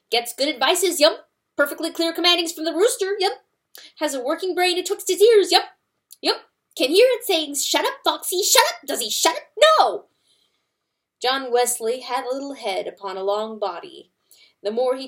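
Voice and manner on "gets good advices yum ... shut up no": loud, higher angry rant; angry weasel. A narrator's reading. Weasel angrily scolding the dead fox, loud and high. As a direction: rant at the corpse. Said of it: "It sounded mostly angry".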